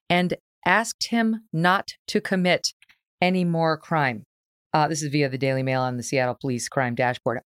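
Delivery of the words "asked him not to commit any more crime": slow, deliberate, spaced-out words; slow, pointed pauses for emphasis; incredulous emphasis. Real, not acted. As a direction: spell it out word by word in disbelief. Incredulously spelling out judge's request.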